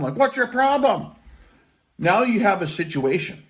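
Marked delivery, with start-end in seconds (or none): 0.2-1.1 high-pitched mock yell of protest. Guys yelling at the private messing with the jet, mock yell. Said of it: "yelling at him".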